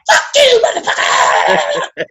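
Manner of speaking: high, furious scream of fuck you; retold wife screaming down the phone. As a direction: shriek it in rage.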